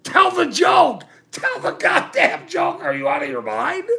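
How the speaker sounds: high, loud exasperated shouting; retold furious demand. Yelling at friends who won't tell the joke, a high, loud retold outburst. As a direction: bark the demand like you can't believe them. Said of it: "And I'm like".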